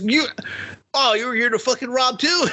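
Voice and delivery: high-pitched, incredulous imitation; hammed-up surprised question; mock shock. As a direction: voice the robber's astonished realization way up high. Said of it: "just, like, shocked".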